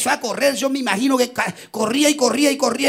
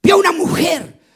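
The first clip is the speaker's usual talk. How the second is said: impassioned sermon climax; fiery preaching; 6 semitones higher than usual. Acted. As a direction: proclaim it to the whole congregation. Preacher narrating miracle, full force.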